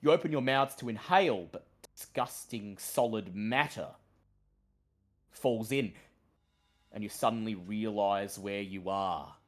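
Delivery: low, slow, ominous storyteller voice; dramatic narration. A narrator's reading. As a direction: draw the players into the darkness. GM narrates the death scene.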